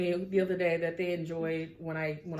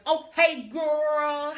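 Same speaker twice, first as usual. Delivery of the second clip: high, drawn-out gushy hello; elongated mock greeting; 7 semitones higher, 5 dB louder, much slower than usual. Mimicking a friend who greets her as she walks past, elongated. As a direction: stretch it out in a put-on accent. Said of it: "Why are you elongating your words?".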